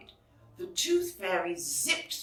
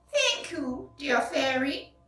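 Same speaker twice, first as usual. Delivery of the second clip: put-on high, grateful old lady; tender and thankful; 6 semitones higher than usual.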